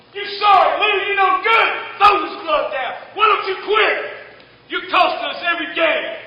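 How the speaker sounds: loud high furious yelling at a teammate; angry shout. Retold or imitated. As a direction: chew him out loudly. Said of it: "Shortstop's mad".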